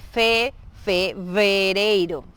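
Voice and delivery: FE, FEVEREIRO drawn out slowly, v distinct; slow teaching model of "fevereiro"; clear Portuguese V. Shown as a demonstration. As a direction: break "fevereiro" up slowly after "fe". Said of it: "siempre tratar de pronunciar bien la B".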